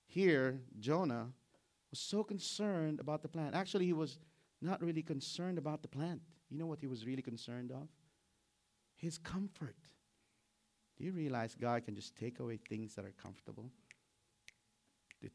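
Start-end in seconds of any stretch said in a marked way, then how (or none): none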